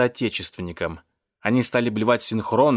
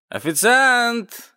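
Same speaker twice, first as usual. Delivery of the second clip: high, drawn-out call; cat's loud complaint to waiter; 11 semitones higher, 4 dB louder, slower than usual. A narrator's reading. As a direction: holler it slowly, pitched way up. Cat calls waiter again.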